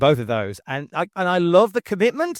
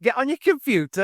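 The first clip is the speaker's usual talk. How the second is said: ordinary talk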